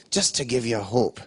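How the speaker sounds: usual speaking voice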